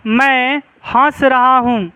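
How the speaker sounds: slow and clear, word by word, for the class to write down; slow classroom dictation. Shown as a demonstration. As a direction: dictate it slowly so they can write. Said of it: "जैसे लिखो".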